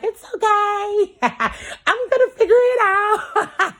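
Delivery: high, slow, giggling mock reassurance; mock carefree collective. Mocking the collective's easy calm, high and slow with a giggle. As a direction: mimic someone breezily sure they'll figure it out. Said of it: "you over here like".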